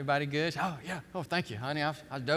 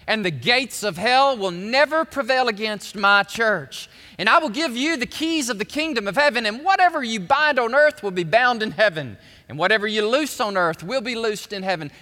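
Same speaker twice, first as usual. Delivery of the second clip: loud, ringing pulpit delivery of Jesus' words; preaching at full force; 4 semitones higher, 10 dB louder than usual. Acted. Preacher voicing Jesus blessing Simon Peter, preaching loudly. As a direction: proclaim it like a preacher.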